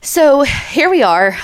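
upbeat, high, slow announcement; peppy. Real, not acted. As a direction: perk up and present it. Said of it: "I'm a little peppier now".

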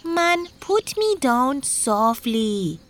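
high, drawn-out branch voice; high, slow talking-branch character; talking palm branch. Acted. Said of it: "the palm branch said".

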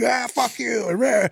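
high, held-back imitation of a yeller; mock street yelling. Imitating a guy yelling on the street, mock angry. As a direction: mimic a ranting stranger without full volume. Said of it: "like, yelling shit on the street".

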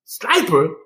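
high-pitched imitated outrage; hot, indignant mom. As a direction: echo the accusation as an outraged mother. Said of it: "My mom got hot".

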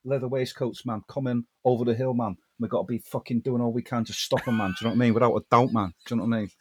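low, soft Mancunian rocker swagger. Acted. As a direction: parody a rock star playing a fantasy hero. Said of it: "So if Jon Snow was [name]".

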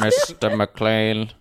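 slow, drawn-out villain voice; lightly voiced Hans Gruber; villain impression. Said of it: "and he goes".

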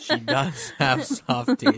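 slow, teasing through laughter; laughing. Real, not acted. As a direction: say it while cracking up. Teasing a friend amid laughter.

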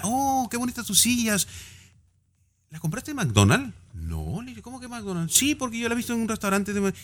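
a chatty little exchange, played lightly; quoting the friend. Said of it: "una amiga".

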